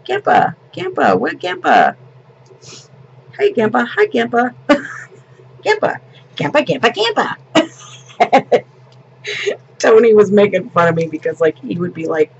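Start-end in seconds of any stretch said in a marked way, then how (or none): 0.1-4.5 put-on high, eager toddler calls; imitating a toddler. Grandma mimicking her grandson's all-day calls for Grandpa, toddler voice. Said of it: "all we heard all day from Paxton". 5.6-8.6 put-on high, eager toddler calls; imitating a toddler. Grandma mimicking her grandson's all-day calls for Grandpa, toddler voice. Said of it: "all we heard all day from Paxton".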